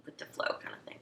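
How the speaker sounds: soft whispered trailing off; whispered aside. Real, not acted. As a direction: breathe it away. Unvoiced trailing phrase.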